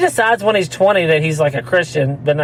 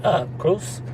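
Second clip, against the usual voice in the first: softly imitated four-year-old, slurring the name; soft, unclear little-kid answer; mumbling small kid; 7 dB quieter than usual. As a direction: lightly imitate a tiny kid who can't talk well. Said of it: "he don't talk well".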